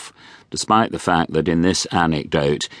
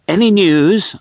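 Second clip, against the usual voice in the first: high, louder posh call; a touch of a chirpy posh actor; 13 semitones higher, 6 dB louder than usual. A narrator's reading. Nigel peers round door.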